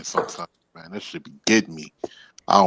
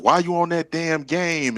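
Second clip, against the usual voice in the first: higher, drawn-out nagging complaint; slow, raised-pitch mock scolding; 7 semitones higher, slower than usual. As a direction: imitate an exasperated partner's nagging. Imitates partner complaining about gaming.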